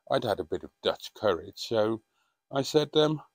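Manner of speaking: ordinary talk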